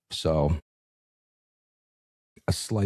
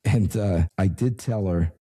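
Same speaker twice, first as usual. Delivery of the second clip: ordinary talk, nothing marked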